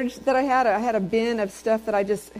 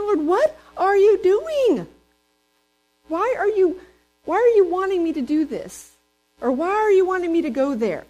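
whiny complaint to God; 9 semitones higher, 5 dB louder than usual. Retold or imitated. Believer complaining to the Lord, much higher and whiny. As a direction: whine at God like a put-upon child. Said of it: "don't we do that all the time".

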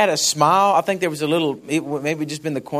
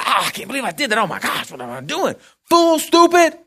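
Peter's self-rebuke; 6 semitones higher than usual. Retold or imitated. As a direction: berate yourself, the last words sharply.

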